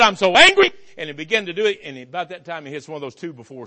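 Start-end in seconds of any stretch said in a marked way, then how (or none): 0.0-0.7 angry shout. Retold or imitated. Drunk man with gun raging.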